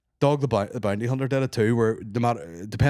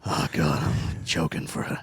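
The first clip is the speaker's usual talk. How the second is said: mock gasping; 8 semitones lower, 3 dB quieter than usual. Playing Seagal gasping for drink.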